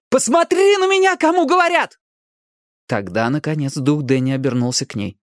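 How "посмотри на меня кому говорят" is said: very high, insistent order; commanding demand. A narrator's reading. Ali demanding Danny's attention, commanding shout. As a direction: order him sharply as a commander. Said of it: "потребовала она".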